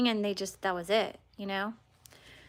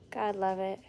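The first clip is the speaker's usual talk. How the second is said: usual speaking voice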